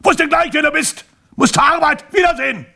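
very high, loud clipped shouts; shouting. A narrator's reading. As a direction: keep yelling every phrase. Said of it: "schrie Herr Schelmhorn".

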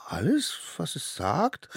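put-on high child voice, rising question; doubtful little one. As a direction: ask in small-voiced disbelief. (hohe, ungläubige Kinderfrage)

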